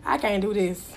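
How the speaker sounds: low, subdued refusal; acted giving up. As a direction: back out quietly, done with it. Acting out giving up on lover.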